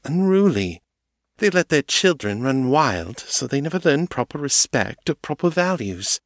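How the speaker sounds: a haughty vampire lady pitying the poor; Amelie, disdainful. A narrator's reading. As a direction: preach your prejudice with regretful certainty. Amelie lectures on street people.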